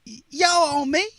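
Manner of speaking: high, slow twangy imitation of Ricky; Harrisburg twang. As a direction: drawl it out like a Harrisburg running back. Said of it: "'Cause that's how he talked".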